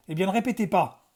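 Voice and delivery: ordre sec, plus fort et plus haut; aboie-le comme un professeur excédé; aboiement. A narrator's reading. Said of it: "aboya-t-il". (ton aboyé)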